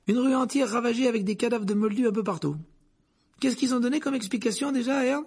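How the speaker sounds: high, animated gossip; excited conductor. A narrator's reading. Conductor asking the driver about the cover-up, excited. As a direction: pitch it up, eager and loud.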